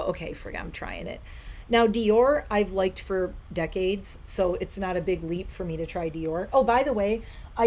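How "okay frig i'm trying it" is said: muttered under her breath; quiet resigned aside; hushed aside. Retold or imitated. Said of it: "made me go".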